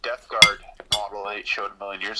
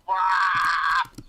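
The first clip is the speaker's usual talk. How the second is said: mock blah shout; 5 semitones higher, 7 dB louder than usual. Mocking chat spam.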